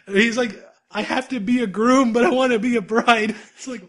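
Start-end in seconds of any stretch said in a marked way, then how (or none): 0.9-3.3 high-pitched, hammed-up lament; mock wailing. Retelling Megu confessing a wish to be a bride, mock wailing. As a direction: wail it like a melodramatic anime character. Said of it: "he's sobbing".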